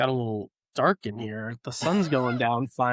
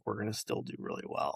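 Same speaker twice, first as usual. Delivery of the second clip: quiet hopeful inner voice; 3 semitones lower, 10 dB quieter than usual. Retold or imitated. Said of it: "little bit of me that's like".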